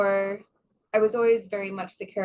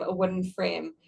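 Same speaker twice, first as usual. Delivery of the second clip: normal talking voice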